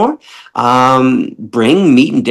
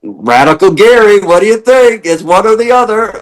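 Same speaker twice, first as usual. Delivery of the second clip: higher, proud declaration; mock bold self; 8 semitones higher, 3 dB louder than usual. Gary answering doubtful friends, playfully boastful. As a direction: boast playfully, all or nothing. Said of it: "I'm like".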